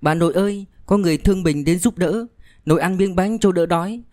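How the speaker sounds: put-on high-pitched young girl's voice; pleading granddaughter. A narrator's reading. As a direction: coax grandma to eat in a high child's voice. Girl urging sick grandmother to eat. (giọng cháu gái cao, nài bà ăn)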